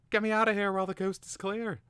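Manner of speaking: high, loud, urgent bird demand; snapping. A narrator's reading. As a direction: order the bystander sharply. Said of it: "it snapped".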